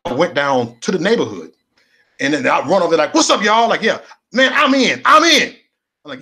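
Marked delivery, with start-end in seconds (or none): 3.1-5.6 loud, high, pumped-up yell of joining; hyped gang greeting. Retold or imitated. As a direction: shout it like you just got in.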